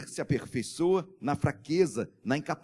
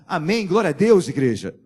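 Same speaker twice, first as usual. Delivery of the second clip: loud rising call to the church; full-voiced preacher's shout; shouted praise; 10 dB louder than usual. Real, not acted. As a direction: lift the crowd with a cry of praise. Preacher calls out to congregation.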